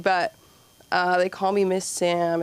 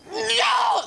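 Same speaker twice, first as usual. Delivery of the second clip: sudden high yelp of protest; kids' outraged no. Retold or imitated. Kids told of a five-minute break, high outraged cry. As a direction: cry it out like a crowd of upset kids.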